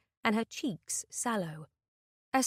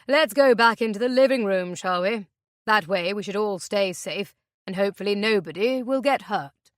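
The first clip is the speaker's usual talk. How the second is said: menacing captor; 8 dB louder than usual. Acted. Captor orders them, knife in hand.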